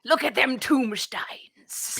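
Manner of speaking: put-on slow gruff old sailor voice; old sea dog. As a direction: drawl it like a grizzled sea captain. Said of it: "and he would say, like".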